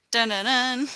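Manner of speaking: sung-out suspense sting; mock dramatic sting. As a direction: punctuate the reveal with a playful soundtrack sting. Listener imitates dramatic sting for reveal.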